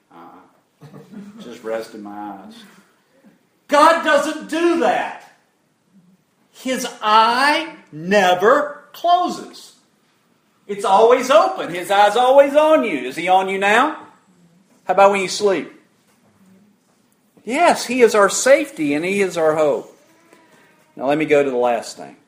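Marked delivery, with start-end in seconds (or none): 0.1-2.6 sleepy denial. Acted. Dozer on the couch denying sleep, drowsy murmur. Acting someone caught dozing on couch. 3.7-20.0 high, loud, emphatic pulpit delivery; full-force preaching. Real, not acted. Preacher assuring the congregation God never sleeps, fervent preaching.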